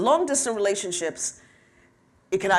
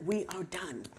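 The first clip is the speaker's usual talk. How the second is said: retold quiet finality; 13 dB quieter than usual. Breaking up with him.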